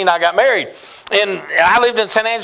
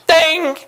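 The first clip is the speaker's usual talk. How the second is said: ding sound effect; 7 semitones higher than usual. Retold or imitated. Imitated bell sound.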